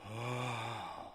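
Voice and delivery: a low, soft, drawn-out mock moan; reenacted soft moan. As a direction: sigh out a pretend moan on request. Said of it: "can you reenact these moans".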